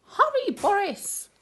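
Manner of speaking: high, loud urgent call; urgent cry. Acted. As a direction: call out in alarm. Friends urging Boris.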